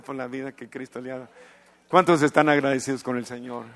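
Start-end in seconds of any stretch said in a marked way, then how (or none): 1.9-3.7 loud call to the congregation to give thanks; rallying shout. Real, not acted. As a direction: whip up the crowd, call out loud for applause. Pastor rallies congregation to applaud. (grito fuerte a la congregación para aplaudir)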